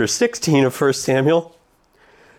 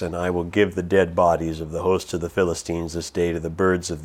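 scripture reading; 6 semitones lower than usual. Acted. Preacher reading David's challenge to the Philistine, low and solemn. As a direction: let David's words land heavy and low.

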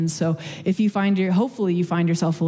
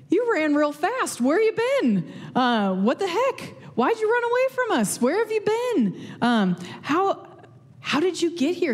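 much higher, winded and bewildered; out-of-breath crowd; 8 semitones higher than usual. Retold or imitated. Crowd finally catching up with Jesus, breathless and baffled. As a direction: play a panting crowd that just ran after someone. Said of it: "I like to picture them out of breath".

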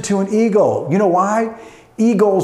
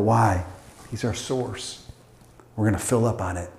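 much lower, subdued pastoral tone; hushed preaching; 10 semitones lower, 4 dB quieter than usual. Real, not acted. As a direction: drop low and let it settle, with pauses. Drops into intimate exhortation.

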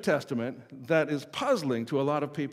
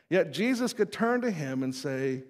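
usual speaking voice

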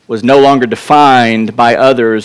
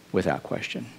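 softer echo, nearly under the breath; quiet echo; 4 semitones lower, 15 dB quieter than usual. Acted. Preacher echoes his point to the church, much softer. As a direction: repeat it softly to yourself. Repeated affirmation.

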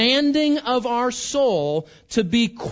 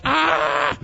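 mock cry of frustration; 6 semitones higher than usual. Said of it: "you're like".

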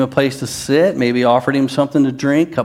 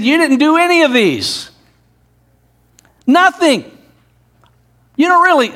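Jesus rebuking; 12 semitones higher than usual. Acted. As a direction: ring out Jesus's accusation. Preacher voices Jesus rebuking Simon.

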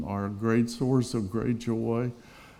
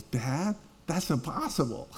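softer, higher mock protest; protesting teenage son; 4 semitones higher, 6 dB quieter than usual. Son in bed answering dad's Bible advice, mock protest. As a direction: imitate a son softly objecting to dad.

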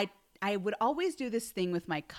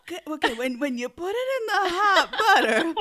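mock scientist; 7 semitones higher, 4 dB louder than usual. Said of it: "Miss Scientist".

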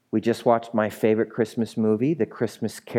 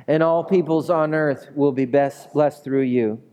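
raised, measured congregational reading; Scripture read aloud; 3 semitones higher than usual. Acted. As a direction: lead a room in reading the verse, pitched up.

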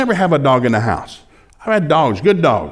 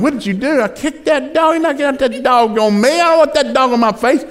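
high-pitched comic outburst; agitated retelling; 10 semitones higher than usual. Retold or imitated. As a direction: blurt it out high, acting worked up. Acted night quarrel with woken wife.

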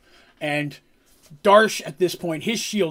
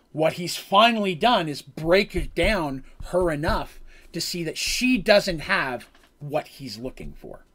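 ordinary talk

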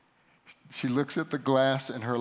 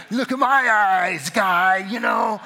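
much higher and louder taunt at the other driver; mock challenge; 7 semitones higher, 11 dB louder, slower than usual. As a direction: stare him down, calling out loud. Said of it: "And he, I'm".

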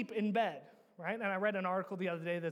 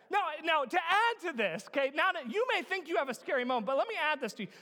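raised, high storytelling voice; animated preaching; 7 semitones higher, 5 dB louder than usual. Real, not acted. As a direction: build up the scary story with urgency. Preacher builds up comic story.

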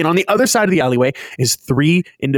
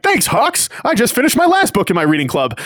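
high, hearty thank-you; hammed-up oblivious hero cheer; cheerful All Might; 7 semitones higher than usual. All Might thanks Hawks for the book, high and oblivious.

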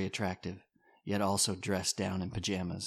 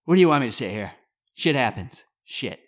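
loud exasperated; 4 semitones higher, 10 dB louder than usual. Acted. Rich venting to Don.

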